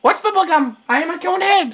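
high-pitched, stiff alien voice; Conehead. Acted. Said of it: "I'm gonna do my Conehead impression".

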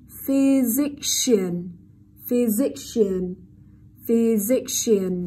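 physician very slowly, with a long sh in -cian. Shown as a demonstration. Teacher drills the class, physician slow with long sh. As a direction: draw out "physician", holding the SH. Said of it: "sh dài".

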